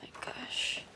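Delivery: breathy exasperated whisper. Real, not acted. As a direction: sigh it under your breath. Frustrated aside, whispered.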